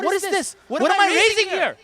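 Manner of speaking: high-pitched, exasperated outburst; exasperated father. Retold or imitated. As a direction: act out a dad in disbelief. Exasperated dad reacts to son.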